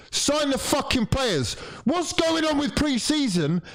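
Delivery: high furious demand; angry shout. Real, not acted. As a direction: yell at the club. Fan demands signings.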